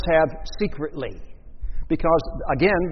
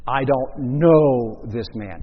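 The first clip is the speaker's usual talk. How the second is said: slow, emphatic denial; much slower than usual. Retold or imitated. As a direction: spell it out slowly and firmly. Peter cursing, denying Jesus.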